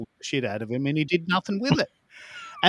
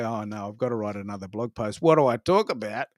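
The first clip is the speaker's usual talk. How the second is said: mock weary groan; 5 semitones lower, 3 dB quieter than usual. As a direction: lower and softer, a dragging sigh of a complaint. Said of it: "You don't wake up in the morning and say".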